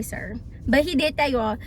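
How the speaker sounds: usual speaking voice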